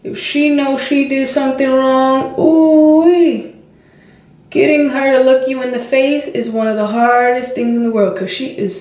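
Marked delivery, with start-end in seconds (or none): 2.4-3.5 a high, loud ooh-wee; loud whooping ooh-wee; excited exclaim. Real, not acted. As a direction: let the exasperation burst out. Exclaiming about daughter's stubbornness.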